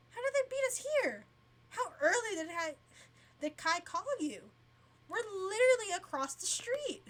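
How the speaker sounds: can't believe they got here first; confused surprise. Acted. Sister baffled the family beat them there, high loud disbelief.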